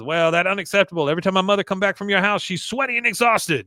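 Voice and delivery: high, fast mock-angry scolding; Con, pissed. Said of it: "Con is coming up pissed".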